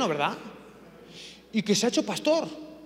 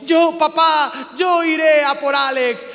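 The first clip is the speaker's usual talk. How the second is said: loud, higher, slow mock-heroic cry; heroic volunteering shout; 6 semitones higher, 10 dB louder, slower than usual. The son volunteering to his father, hammed-up shout. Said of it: "el hijo dijo".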